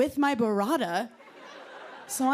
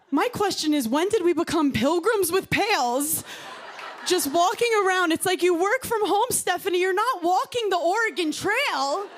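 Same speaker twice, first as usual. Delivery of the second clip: mock exasperation; 8 semitones higher than usual. Mocking Stanley-cup girls.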